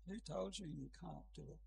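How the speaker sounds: gentle, hushed challenge. Retold or imitated. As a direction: ask it gently, as a counsellor would. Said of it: "very gently I said".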